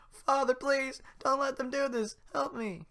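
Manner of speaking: put-on very high, loud desperate boy; Pinocchio pleading. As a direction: beg your father. Pinocchio begging.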